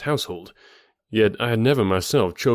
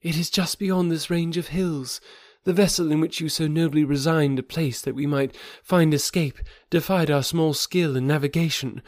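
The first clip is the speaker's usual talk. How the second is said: higher voice, mournful account; grieving young woman; 7 semitones higher than usual. A narrator's reading. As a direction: recount his fall with sorrow. Thuvia's long account continues.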